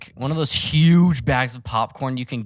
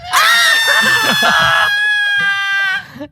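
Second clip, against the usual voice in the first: long, high-pitched loud scream; drawn-out shriek for show; mock scream; 5 dB louder than usual. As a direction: scream it out and hold it. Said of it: "And scream, though".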